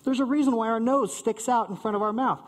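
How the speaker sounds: normal talking voice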